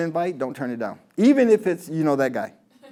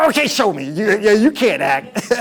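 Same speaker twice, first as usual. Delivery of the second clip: Freeman teasing; 5 semitones higher, 6 dB louder than usual. Retold or imitated. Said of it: "so he said".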